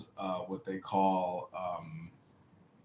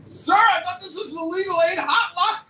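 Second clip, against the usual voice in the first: laughing disbelief; 9 dB louder than usual. Retold or imitated.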